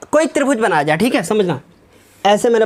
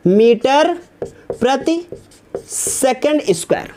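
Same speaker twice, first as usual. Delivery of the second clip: read it out slowly; slower than usual. Shown as a demonstration. Teacher showing students how to read the unit aloud, slow and careful. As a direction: spell out the unit carefully, not as minus. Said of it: "इसको minus नहीं पढ़ते हैं".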